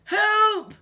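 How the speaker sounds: loud, high panicked shout; high-pitched yell from a frightened crook; cry for help. A narrator's reading. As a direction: cry out in alarm. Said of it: "cried Hugh".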